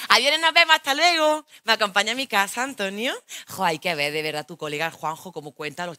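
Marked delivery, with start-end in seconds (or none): 0.1-3.1 higher, louder bubbly farewell; cheerful goodbye. Retold or imitated. Girl saying bye to his mates, bright and loud. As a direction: chirp goodbye to the friends. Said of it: "y yo".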